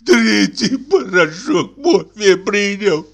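high, slow gasping invalid; having a heart attack. Acted. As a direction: wheeze it out, clutching the heart. Sick man's heart attack continues. (больной задыхается от сердечного приступа)

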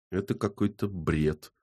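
muted, reluctant-sounding utterance; forced out. A narrator's reading. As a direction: squeeze the words out quietly. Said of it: "выдавил из себя Львов".

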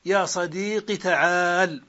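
"صديقي" ending on a brief i; slow demo, keep the final i of "صديقي" short; kasra without ya. Shown as a demonstration. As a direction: say "صديقي" as sadīqi, straight into "تعال". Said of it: "بقاف مكسورة دون ياء".

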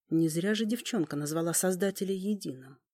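ordinary delivery, nothing marked

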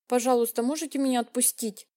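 ordinary delivery, nothing marked

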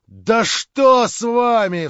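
high, loud and slow cry; exclaimed. A narrator's reading. Stavrogin crying out at the pursuing Verkhovensky. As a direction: cry out in alarm. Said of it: "вскричал Ставрогин".

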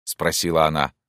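ordinary delivery, nothing marked

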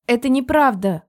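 loud, high protest; exclaiming. A narrator's reading. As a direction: cry out in protest. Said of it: "воскликнула я".

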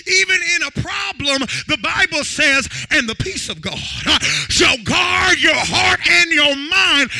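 loud, rising sermon climax; full-force preaching. Real, not acted. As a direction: preach it out to the whole church.

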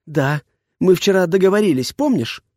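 put-on high, rising girl's reproach; indignant girl. A narrator's reading. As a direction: remind him pointedly. Heroine argues with boss.

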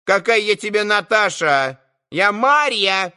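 put-on market woman yelling back at a cheeky boy, high pitched; shrill shout. A narrator's reading. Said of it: "крикливо ответила торговка".

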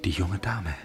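breathless whisper as she walks in; hushed whisper; whispered aside. Acted. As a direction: say it under your breath, struck. Noticing her at the door.

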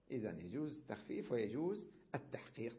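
ordinary delivery

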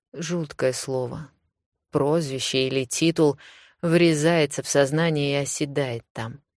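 plain reading voice

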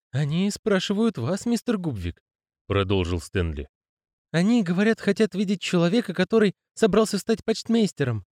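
high timid lad voice. Acted. Stanley's voice, high.

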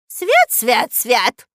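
high, loud frightened cry; startled old woman crossing herself. Acted. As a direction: gasp it out in holy alarm. Старушка пугается, крестится. (испуганно, громко: свят, свят)

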